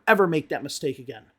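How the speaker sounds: ordinary talk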